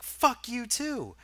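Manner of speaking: high, drawn-out mock scream; retold screaming. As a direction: imitate a screaming customer, stretched out but not full volume. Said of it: "the guy who's screaming is like".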